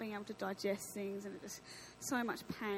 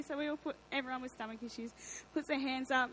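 ordinary talk, nothing marked

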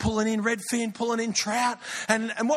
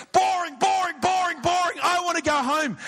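put-on bored child's shout; 8 semitones higher than usual. Sam interrupts the long service again: high whining shout. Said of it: "Sam stands up and goes".

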